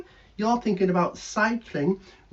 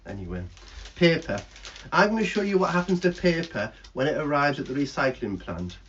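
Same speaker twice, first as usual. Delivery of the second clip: ordinary talk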